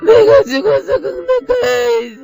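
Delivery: usual speaking voice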